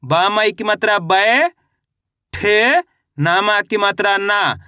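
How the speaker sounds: 'बय', 'ठे', 'ना' धीरे और खींचकर, अलग-अलग; 'बय' यानी 'बै' को लंबा, चढ़ते सुर में, फिर 'ठे' अलग, 'ना' गिरते सुर में; अक्षर-अक्षर हिज्जे. Shown as a demonstration. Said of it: "अच्छे से आपको इसका उच्चारण सुनना है". (बच्चों को पढ़ाने जैसे 'बय', 'ठे', 'ना' तोड़कर बोलें)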